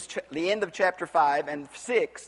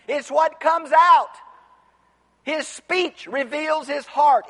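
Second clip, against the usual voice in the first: high, loud, emphatic pulpit delivery; impassioned preaching; 6 semitones higher, 5 dB louder than usual. Acted. Preacher quoting Jesus on the food laws, impassioned. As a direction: drive each point home, raised voice.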